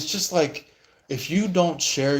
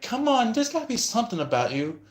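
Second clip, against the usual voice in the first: coaxing; 11 semitones higher, faster than usual. Retold or imitated. Pushy person coaxing for details.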